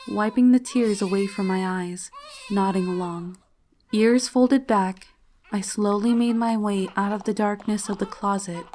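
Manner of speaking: ordinary delivery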